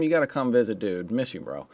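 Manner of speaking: usual speaking voice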